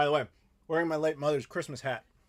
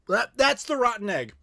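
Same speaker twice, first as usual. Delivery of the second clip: talking with a mouthful of something foul; eating gross jelly beans; 7 semitones higher, 6 dB louder than usual. Real, not acted. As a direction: chew and wince through the words. Tasting rotten egg jelly bean.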